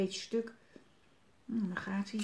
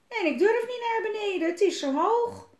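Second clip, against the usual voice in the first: put-on high, louder child voice; scared little boy; 13 semitones higher, 7 dB louder than usual. A narrator's reading. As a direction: whine high like a boy afraid to climb down. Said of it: "zegt het jongetje". (bang jongetje, hoog stemmetje)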